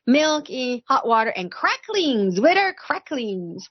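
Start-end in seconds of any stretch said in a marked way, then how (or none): none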